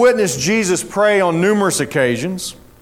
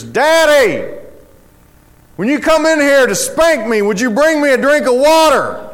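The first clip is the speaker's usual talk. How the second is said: put-on child calling out; 6 semitones higher than usual. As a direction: call out sweetly like a bold little boy. Son asks once more, cheekily.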